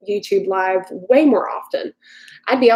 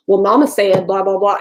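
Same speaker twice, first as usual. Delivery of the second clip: louder burst of Southern twang; thick Southern accent; 4 dB louder than usual. Retold or imitated. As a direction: imitate your own Southern accent slipping out thick. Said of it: "my Southern accent only comes out".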